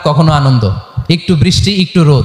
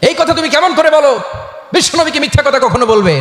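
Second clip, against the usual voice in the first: angry father; 7 semitones higher than usual. A narrator's reading. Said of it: "রেগে মেগে আগুন".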